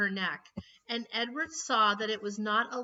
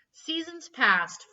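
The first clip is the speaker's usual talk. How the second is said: usual speaking voice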